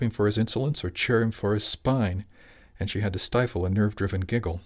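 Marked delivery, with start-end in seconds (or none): none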